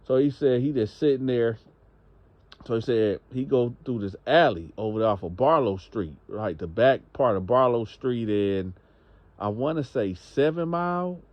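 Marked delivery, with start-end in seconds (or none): none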